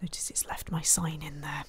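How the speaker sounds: soft whispered remark; hushed whisper; whispered aside. Real, not acted. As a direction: murmur it as an aside. Aside while playing.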